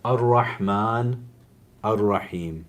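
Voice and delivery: reverent invocation. Shown as a demonstration. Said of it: "names of God".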